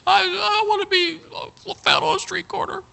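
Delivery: put-on very high, wailing little-boy voice; hammed-up bawling, squeal it out to Daddy; bawling child. Little boy running to Daddy over brothers' lie, bawling. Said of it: "Oh, he's bawling".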